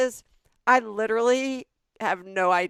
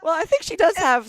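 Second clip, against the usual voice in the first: laughing; 14 semitones higher, 9 dB louder than usual. Real, not acted. Laughing at joke about Mommy.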